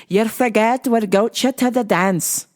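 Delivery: put-on Scottish accent, emphatic. Hunting for the Irish one, landing on Scottish accent. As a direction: slip into a thick Scottish accent. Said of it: "that's my Scottish accent".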